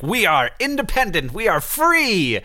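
high-pitched mock-triumphant declaration; patriotic proclamation. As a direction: proclaim it, stretching the last word. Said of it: "We think of independence just like".

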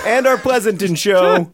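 can't keep a straight face; laughing. Real, not acted. Announcing the California shows, laughing aloud. Jokey plug, cracking up.

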